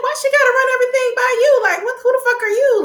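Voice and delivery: very high, fast, incredulous sass; high indignant clapback. Retold or imitated. Viewer sassing Tommy for prying, very high and fast. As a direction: fire it back way up high, with a laugh.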